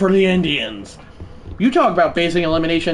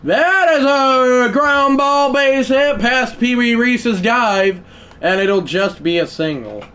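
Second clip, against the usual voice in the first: higher, excited broadcaster call; play-by-play call; 7 semitones higher, 4 dB louder, slower than usual. Acted. Gamer narrating his own game as announcer, excited call. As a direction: sell the grounder like a TV announcer.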